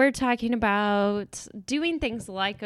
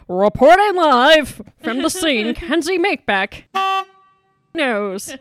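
loud, higher, slow over-the-top news anchor; newsiest voice; 7 semitones higher, 12 dB louder, slower than usual. Retold or imitated. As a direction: ham up the newsiest reporter voice to your crew. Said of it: "in the newsiest voice I could think of".

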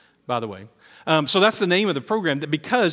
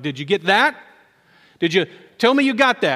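higher, louder excited retold question; eagerly demanding confirmation; excited urgent check; 5 semitones higher, 6 dB louder than usual. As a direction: re-enact your urgent check that it was caught. Excitedly quizzing his cameraman friend.

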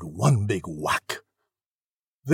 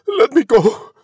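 high, loud cry to be released; shout; 12 semitones higher, 9 dB louder than usual. A narrator's reading. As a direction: shout it high, pulling free. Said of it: "As Cindy shouted".

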